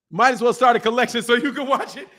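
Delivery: repeating it through real laughter; incredulous laughing repeat. Real, not acted. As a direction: crack up as you say it again. Repeats the joke, cracking up.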